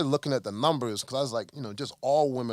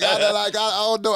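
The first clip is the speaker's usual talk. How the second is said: much higher and louder, giggling and unsure; flustered laugh; 9 semitones higher, 8 dB louder than usual. Retold or imitated. Tiff, voiced by her partner, flustered and laughing about money. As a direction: stammer through a laugh.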